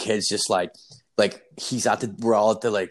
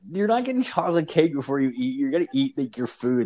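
higher-pitched retold telling-off; exasperated scolding; 4 semitones higher than usual. Babysitter refuses the kid cake before dinner, exasperated. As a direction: lay down the dinner rules, worked up.

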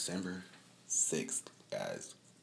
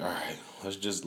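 whispered to himself; 5 dB quieter than usual. Retold or imitated. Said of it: "I'm like".

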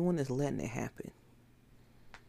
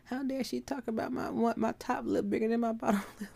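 much higher, whiny put-on voice of a self-pitying believer; mock crybaby whine; 9 semitones higher than usual. Preacher mocks a believer praying hurt feelings, high whiny crybaby voice. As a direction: whimper it like a wounded crybaby, mocking. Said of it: "you're crying".

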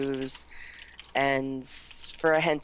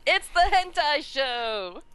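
excited announcement; 10 semitones higher, slower than usual. Real, not acted. Announcing the show theme.

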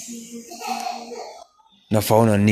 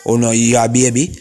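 ordinary talk, nothing marked

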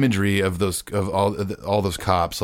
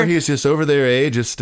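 put-on Canadian accent; 6 semitones higher, 3 dB louder than usual. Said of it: "Those are the worst Canadian accents".

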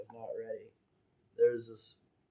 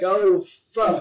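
startled oh fuck; 7 semitones higher, 10 dB louder than usual. Retold or imitated. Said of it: "I'm like".

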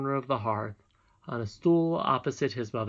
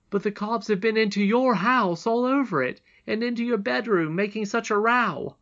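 put-on high, loud boy's voice; agitated boy; 9 semitones higher, 6 dB louder than usual. A narrator's reading. Curdie warning the princess about the cobs, agitated. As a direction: break the alarming news, pitched up and forceful.

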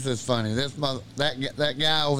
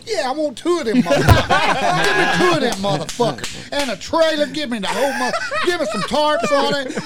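higher and louder, laughing through it; laughing gloat; 11 semitones higher, 7 dB louder than usual. Retold or imitated. Retelling how he ordered two Sea-Doos to show the salesman, laughing triumph. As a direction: crow with triumph between laughs.

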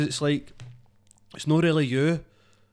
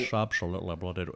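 character voice; 7 semitones lower, 7 dB quieter, faster than usual. Retold or imitated. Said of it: "I do the voice to my bird".

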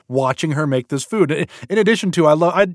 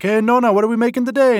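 high, quick cheery grandson greeting; grandson's voice; 8 semitones higher, faster than usual. Retold or imitated. Grandson greeting grandma on camera, high and bright. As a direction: chirp it like an eager grandson. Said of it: "the grandson's always like".

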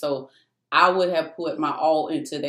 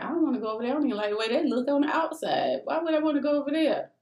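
high, fast, whining complaint; pitched-up mock whine, speeding up; whiny protest; 9 semitones higher, much faster than usual. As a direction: rush it out like a pouting child. Retold complaint to God.

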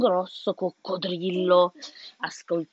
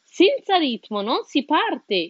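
millipede voice; 6 semitones higher, slower than usual. A narrator's reading. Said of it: "il millepiedi che disse".

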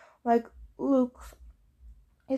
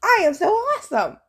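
high, loud proud recital; bellowed; 8 semitones higher, 6 dB louder than usual. Acted. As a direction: boast it to everyone. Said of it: "he bellowed".

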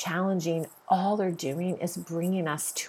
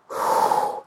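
heavy emotional sigh; 4 dB louder than usual. Real, not acted. Overwhelmed by love for daughters.

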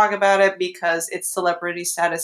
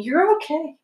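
high-pitched soothing pet talk; little lilting comfort to a pet; reassuring a dog; 9 semitones higher than usual. Real, not acted. Soothing their barking dog.

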